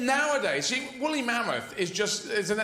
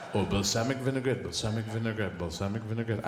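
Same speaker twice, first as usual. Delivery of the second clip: much lower, purring balsamic vinaigrette, a mock seduction; suggestive; 9 semitones lower than usual. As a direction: croon the dressing name like a come-on. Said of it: "some suggestive thing".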